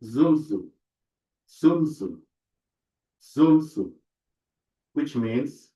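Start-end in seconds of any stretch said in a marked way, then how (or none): 0.0-3.9 Zumzu with mz, a voiced z; pronunciation demo. Shown as a demonstration. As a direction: say Zumzu with a buzzing z after m. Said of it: "Another example is the word".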